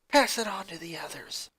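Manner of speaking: breathy whispered order. Acted. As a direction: whisper the last order to his sister. Lincoln ends his hushed ultimatum.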